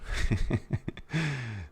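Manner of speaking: helpless, wheezy laugh; real laughter. Real, not acted. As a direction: let a real laugh slip out. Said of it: "Sorry for laughing".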